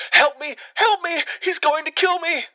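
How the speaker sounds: very high, frantic cries for help; panicked pleading. Retold or imitated. Scared girl begging stranger on the phone, terrified plea. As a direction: beg for your life, terrified. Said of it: "It is a sobbing, scared girl".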